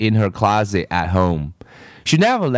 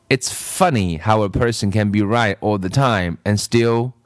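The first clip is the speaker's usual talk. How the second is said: plain reading voice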